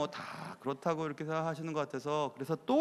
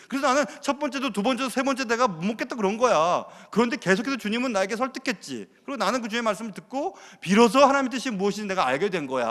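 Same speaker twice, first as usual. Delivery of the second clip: louder, higher voice of Peter telling his story; Peter explaining; 4 semitones higher, 5 dB louder than usual. Acted. Peter patiently explaining to his critics, raised and earnest. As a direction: retell it as Peter, eager and earnest.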